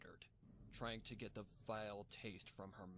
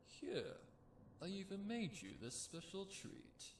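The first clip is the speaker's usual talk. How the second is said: higher, sugary menacing captor; sweet villain voice; 9 semitones higher than usual. Acted. Cult captor offering the caged filly a treat, sugary and menacing. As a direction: coo it falsely sweet like a creepy host. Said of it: "he said".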